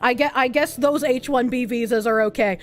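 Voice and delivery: ordinary talk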